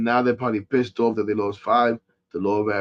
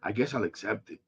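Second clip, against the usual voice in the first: much softer, deflated acceptance; resigned mutter; 3 semitones lower, 11 dB quieter than usual. Real, not acted. Fan resigning himself to trades, soft and deflated. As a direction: give in with a low sigh.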